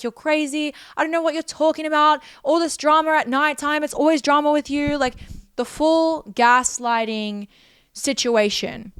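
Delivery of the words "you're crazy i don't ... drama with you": mock dismissive partner. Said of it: "He's like".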